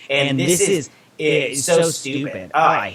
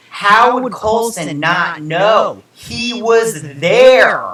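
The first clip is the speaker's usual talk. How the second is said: much higher, louder, very slow disbelief; exasperated outburst; 7 semitones higher, 7 dB louder, much slower than usual. Real, not acted. Ranting to viewers about Coulson's plot hole, with slow, loud, high exasperation. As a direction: spell out how absurd it is, fed up.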